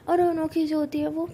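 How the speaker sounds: plain reading voice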